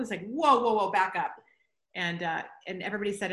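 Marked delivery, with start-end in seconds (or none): 0.3-1.3 loud mock "hold on" outburst; alarmed halting. Colleague stopping an over-excited pitch, loudly halting. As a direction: imitate someone putting the brakes on, loudly.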